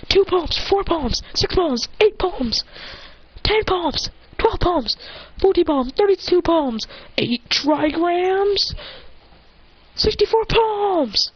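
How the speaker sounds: high mock-anime strike count; attack count. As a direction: chant each strike high, stretching the last ones.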